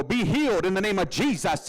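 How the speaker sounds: hammed-up TV preacher punching each phrase; faith healer. TV preacher healing a convert, in hammed-up fervour. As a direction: imitate a televangelist commanding a healing. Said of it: "Christianity is, this guy is gonna go".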